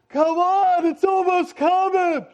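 high, loud mock shout; frantic, stretched-out calling; urgent yell. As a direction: holler down a long hallway as a midwife. Midwife yells down the hall.

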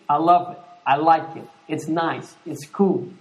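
dutiful praise. Retold or imitated. Dutiful compliments to wife.

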